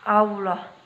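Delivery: "Allah" with a heavy, full-mouthed l and a dark o-like vowel; thick lam in Allah. Shown as a demonstration. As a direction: say "Allah" as aulloh, tongue pressed up so the lam sounds thick. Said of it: "bagaimana kita melafalkan lafdul jalalah".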